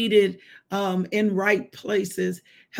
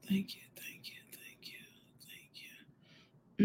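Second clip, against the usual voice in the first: hushed, breath-only thanks to God; soft whispered praise; whispered prayer; 17 dB quieter than usual. Real, not acted. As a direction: murmur thanks under your breath, over and over.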